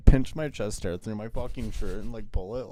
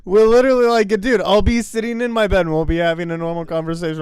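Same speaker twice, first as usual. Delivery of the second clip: heated insistence; 10 semitones higher, 10 dB louder than usual. Real, not acted. Heated complaint about roommate.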